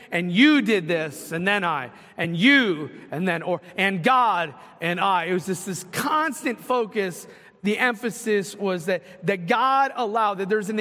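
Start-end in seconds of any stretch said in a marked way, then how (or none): 0.1-5.2 a preacher pointing out David's "you" and "I", punching each pronoun; stress on the pronouns. Retold or imitated. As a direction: lean hard on "you", "God" and "I" each time. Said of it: "notice the difference when he kept saying".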